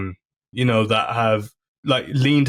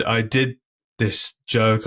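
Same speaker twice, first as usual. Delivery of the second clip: usual speaking voice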